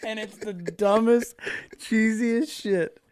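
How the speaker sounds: high, slow, laughing words; laughing at his own cheesy line. Real, not acted. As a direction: squeeze it out through laughter. Laughing at himself.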